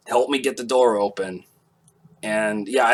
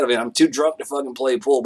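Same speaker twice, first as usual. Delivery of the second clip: usual speaking voice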